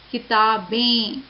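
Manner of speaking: slow किताबें, stretching the nasal एं at the end. Shown as a demonstration. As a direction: spell out किताबें by sound with a nasal final vowel. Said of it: "कैसे pronounce कर रहे हैं, ए". (किताबें, अंतिम एं को नाक से खींचकर)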